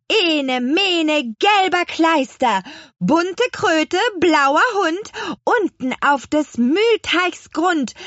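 high, loud, slow declaimed rhyme; spell incantation. A narrator's reading. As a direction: call out a magic spell grandly. Hexe ruft den Zauberspruch. (beschwörend gerufener Zauberspruch)

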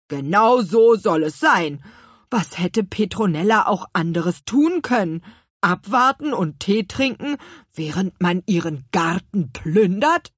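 loud, huffy little character voice; emphatic put-on apple-man voice; indignant apple man. A narrator's reading. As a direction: defend her with loud indignation. Narrator voices the apple man Gurkenhut.

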